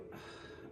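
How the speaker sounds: breathy sigh while holding back tears; heavy sigh. Real, not acted. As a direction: let out a quiet, weary exhale. Fighting back tears while venting.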